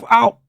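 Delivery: high, short "ow"; mimicked yelp on hitting a car; imitated impact cry. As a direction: sound effect of a bounce.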